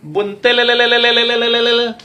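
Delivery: high, loud, rippling trilled street call; buntil vendor's echoing cry. Retold or imitated. Buntil seller calling through the neighbourhood, echoing high cry. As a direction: roll the last syllable out like an echo. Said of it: "Keliling tuh".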